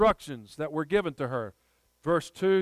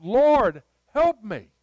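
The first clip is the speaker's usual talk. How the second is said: pleading cry; 6 semitones higher, 10 dB louder, much slower than usual. Pretend. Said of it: "And you're crying out".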